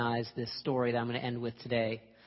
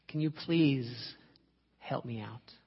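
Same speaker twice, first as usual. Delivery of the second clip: slow, hushed plea fading toward a whisper; weary plea; 3 semitones lower, 3 dB quieter, slower than usual. Acted. Trapped man asks his friend for help, weary plea. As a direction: ask slowly, voice sinking away.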